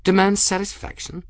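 barely contain your amusement; incredulous, bubbling undertone; suppressed mirth. A narrator's reading. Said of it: "Mirth bubbled underneath the words".

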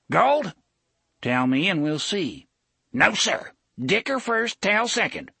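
put-on high, loud, cagey old man; haggling old man. A narrator's reading. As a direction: drive a bargain, sly and shrill.

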